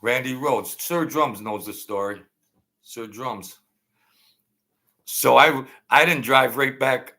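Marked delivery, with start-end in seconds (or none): none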